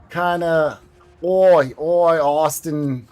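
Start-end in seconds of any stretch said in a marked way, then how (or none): 1.2-3.1 drawn-out Boston O's; Boston accent. Shown as a demonstration. Explaining the Boston sound by example, elongated Boston accent. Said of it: "the kinda elongated O's".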